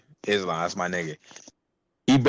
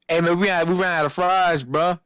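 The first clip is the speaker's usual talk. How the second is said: higher-pitched mock alert; lightly imitated coworker; 7 semitones higher than usual. Coworker reporting they ran out of fries, higher mimicked complaint. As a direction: lightly mimic someone flagging a problem.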